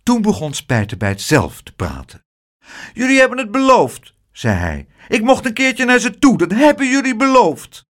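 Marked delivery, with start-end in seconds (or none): 2.9-4.2 put-on high-pitched little boy voice; child's protest. A narrator's reading. Spijtebijt reproaching the adults, high childish protest. 5.1-7.9 put-on high-pitched little boy voice; child's protest. A narrator's reading. Spijtebijt reproaching the adults, high childish protest.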